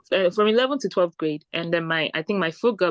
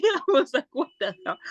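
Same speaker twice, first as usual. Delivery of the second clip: laughing; 6 semitones higher than usual. Real, not acted. Laughing retelling.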